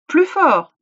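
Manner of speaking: high, loud urging. Acted. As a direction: push him to shout. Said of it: "dit la vieille dame".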